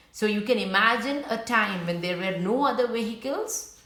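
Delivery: plain reading voice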